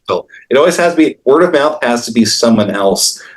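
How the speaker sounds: normal talking voice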